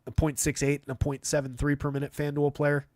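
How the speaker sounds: normal talking voice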